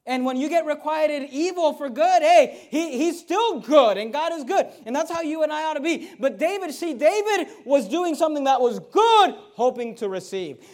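preacher raising his voice on God being good; preaching. Acted. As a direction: drive the point home to the church. Preaching at full force.

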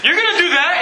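high, rising disbelief; mock incredulous husband. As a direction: question it like a doubting husband. Acting a husband's discouraging question.

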